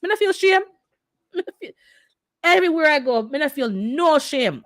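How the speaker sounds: high, loud, hammed-up pidgin voice; mock pidgin boast. Telling the chat she feels no shame, in high mock pidgin. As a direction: brag in a cartoonish broken-English voice.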